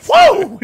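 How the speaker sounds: high, very loud holler; explosive mock battle yell; yelled "whoa". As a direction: bellow like a raging linebacker. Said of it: "Just yelling all the time".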